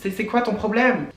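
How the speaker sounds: high, angry outburst; getting angry. Real, not acted. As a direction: snap back in outrage. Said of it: "Ouh, je m'énerve".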